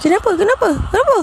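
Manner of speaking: high, rapid-fire questions; retold alarmed questioning. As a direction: imitate friends rushing back in concern. Friends rush back, asking what happened.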